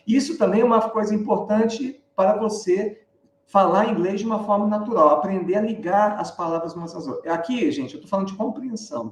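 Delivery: ordinary delivery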